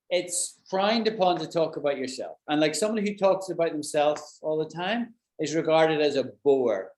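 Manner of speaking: usual speaking voice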